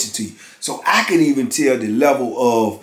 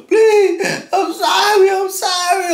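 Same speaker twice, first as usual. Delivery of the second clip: high crying plea; imitated tearful pleading; slower than usual. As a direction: mimic a terrified man saying sorry. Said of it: "telling me".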